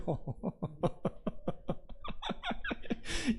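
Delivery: long real laugh after the line; amused laugh. Real, not acted. Pastor telling of an odd contractor, long amused laugh. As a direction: laugh at your own story. Amused by his own story.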